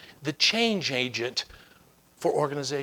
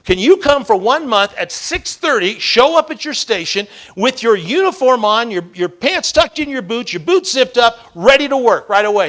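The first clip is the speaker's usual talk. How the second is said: loud, higher, deliberate demand; emphatic challenge; 6 semitones higher, 10 dB louder than usual. Retold or imitated. Mentor sets firefighter a one-month task, emphatic. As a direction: drive each condition home forcefully.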